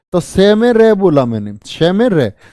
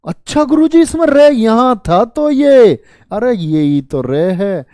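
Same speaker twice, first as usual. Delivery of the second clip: mock student realisation; 5 semitones higher, 3 dB louder than usual. Teacher voicing student's realisation.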